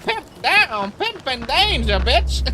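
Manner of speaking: very high, drawn-out panicked pimp cry; Katt Williams pimp yell. Retold or imitated. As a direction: shriek for help while fighting for his life. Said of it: "He's like".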